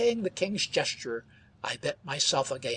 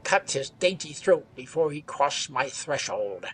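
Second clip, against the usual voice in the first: loud cursing outburst of a desperado; cursing cry; 8 dB louder than usual. A narrator's reading. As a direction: spit the curse out loud. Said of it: "cried the desperado".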